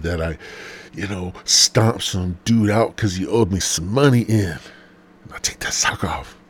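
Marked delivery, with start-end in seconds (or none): 4.5-6.4 slow whispered aside; sleazy whisper. Real, not acted. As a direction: hush it as if sharing a secret.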